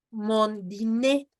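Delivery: slow "mon dîner" ending in a clear "ay"; final E pronounced. Shown as a demonstration. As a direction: say "dîner" as "dee-nay", R silent. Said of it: "ahí sí la pronuncias".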